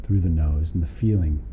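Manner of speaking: calm body-scan guidance with long pauses; guided meditation. Real, not acted. Teacher guiding a group through a body scan, calm meditation voice. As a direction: lead them unhurriedly down through the body, leaving space between phrases.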